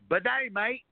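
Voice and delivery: high mock Aussie "Bidet, mate"; Australian accent. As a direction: pun it in a hammy Aussie voice. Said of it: "we got a new saying for your show".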